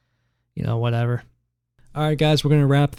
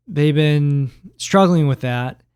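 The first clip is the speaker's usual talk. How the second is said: normal talking voice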